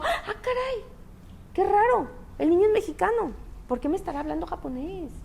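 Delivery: high-pitched, mock-bewildered musing; puzzled amazement. Parent puzzled by adopted child speaking Japanese, high puzzled amazement.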